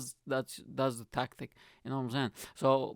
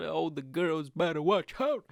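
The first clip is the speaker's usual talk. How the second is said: ordinary talk, nothing marked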